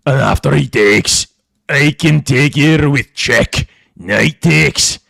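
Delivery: deep, loud hammed-up Scottish accent; deep, loud put-on Scottish accent; mock Scottish accent. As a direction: bellow it like a proud Scottish warrior. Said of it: "that's not even an Irish, Scottish accent".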